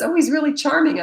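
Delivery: high, loud gushing praise; imitating someone raving about a charming man; mock gushing. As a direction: overdo the admiration. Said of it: "Whenever someone says".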